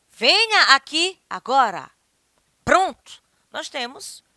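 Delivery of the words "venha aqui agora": loud, slow, raised order; emphatic, insistent command; stern command. Shown as a demonstration. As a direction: summon someone with authority.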